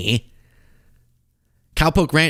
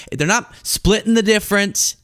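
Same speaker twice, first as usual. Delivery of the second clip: normal talking voice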